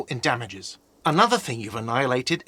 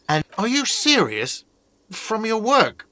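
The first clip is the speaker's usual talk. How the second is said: high-pitched, exasperated disbelief; incredulous; 8 semitones higher than usual. Acted. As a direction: question it as if it were absurd. Disbelief at the guest's question.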